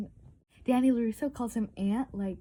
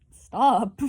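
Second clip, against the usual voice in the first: loud stop; 12 dB louder than usual. Retold or imitated. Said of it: "Mike was like".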